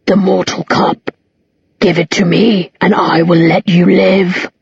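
slow, hollow voice like wind over pavement; a demon's slow windy threat. A narrator's reading. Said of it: "in a voice like the wind blowing trash across empty pavement".

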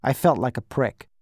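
plain reading voice

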